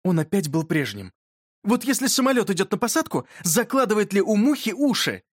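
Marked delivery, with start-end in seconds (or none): 1.6-5.2 higher-pitched, lightly voiced schoolboy question; curious boy. A narrator's reading. Yurka puts a question about a fly to his friend, curious boy. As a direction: ask it like an inquisitive boy.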